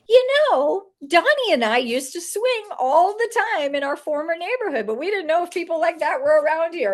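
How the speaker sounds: bright chatty client voice; quoting the client. Retold or imitated. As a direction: perform her eager confession. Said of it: "and she goes".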